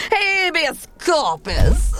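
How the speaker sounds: loud, high, slow incantation; spell cast. Acted. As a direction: hurl the spell at him. Casting a spell on chancellor.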